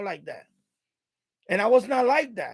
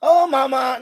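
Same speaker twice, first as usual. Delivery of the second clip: louder, higher sleazy goodbye; mock flirty guy; 4 semitones higher, 6 dB louder than usual. What he would never say to a woman, mock flirt. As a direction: ham up a smooth flirt. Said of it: "I would not say".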